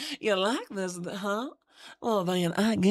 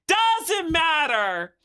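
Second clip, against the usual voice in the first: emphatic; 6 semitones higher, 4 dB louder, much slower than usual. Acted. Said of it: "repeat louder".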